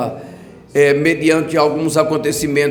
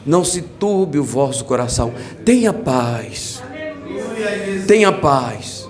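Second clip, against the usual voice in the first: a lower, gentle hint of Jesus speaking; calm reassurance, lowered; voicing Jesus; 4 semitones lower than usual. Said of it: "você não viu Jesus dizer".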